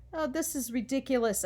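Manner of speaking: ordinary delivery, nothing marked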